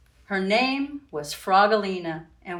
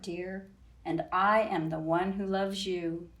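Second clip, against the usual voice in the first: put-on reading to children. A narrator's reading. Reading picture book aloud.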